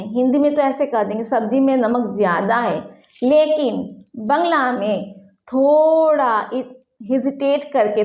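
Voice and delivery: usual speaking voice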